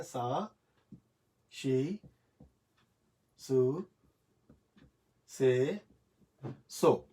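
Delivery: سا، شی، سو، سے، سو, each said alone after a long pause; spaced syllable demo. Shown as a demonstration. Teacher reciting the hiragana s-row for students, one syllable at a time. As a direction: lay out sa shi su se so one at a time. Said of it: "اس کے بعد سا ہے".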